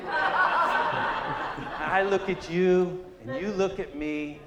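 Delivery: very slow, loud, laughing through it. Real, not acted. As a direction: drag it out while laughing. Laughing through his story.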